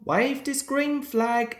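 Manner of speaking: ordinary delivery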